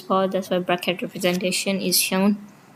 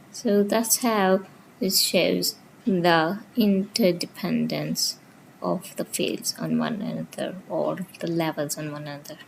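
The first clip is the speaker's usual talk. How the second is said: ordinary talk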